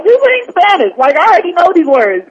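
loud, high-pitched protest; mad, retold. Student protesting basic lessons in advanced Spanish, indignant. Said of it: "I was so mad".

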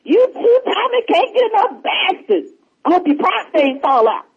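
high, angry woman's voice; hammed-up furious old lady; Peaches impression. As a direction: cuss them out high as Peaches. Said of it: "I'm gonna try to do Peaches".

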